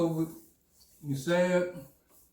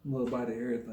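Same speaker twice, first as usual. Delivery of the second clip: ordinary talk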